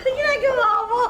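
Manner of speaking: laughing while tickled; giggling through the words; being tickled. Real, not acted.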